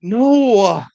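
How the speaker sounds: high, loud, drawn-out no; emphatic refusal. Real, not acted. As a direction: refuse outright, voice leaping up. Refusing to have nose hairs waxed.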